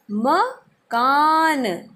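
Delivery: मकान stretched very slowly, each sound held; slow drawn-out word. Shown as a demonstration. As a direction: draw out मकान slowly for the class. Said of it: "क्या कहते हैं?".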